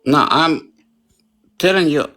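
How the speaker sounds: loud interruption. Real, not acted. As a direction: raise the voice to cut in. Cutting in during argument.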